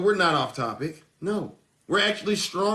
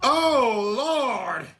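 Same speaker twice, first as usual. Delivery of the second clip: exasperated lament; 3 semitones higher than usual. Real, not acted. Host reacting to bad Supreme Court news, exasperated wail. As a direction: cry out in exasperation, letting it fall.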